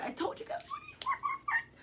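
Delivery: I-told-you-so squeal, shooting sky-high; excited squeal. Real, not acted. Spots repeated numbers on the clock.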